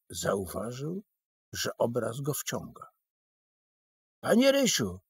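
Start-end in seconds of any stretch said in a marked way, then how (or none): 4.2-5.0 a high, anxious cry for his friend; desperate call. A narrator's reading. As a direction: call out in sudden panic. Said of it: "zawołał rozpaczliwie".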